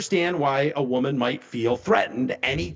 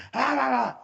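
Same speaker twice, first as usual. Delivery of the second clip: mock shriek; 10 semitones higher, much faster than usual. Imitating her panicked yelps.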